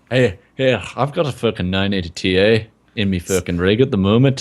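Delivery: put-on Southern Irish brogue; lilting mock Southern Irish; Southern Irish accent. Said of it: "he's doing Southern Irish".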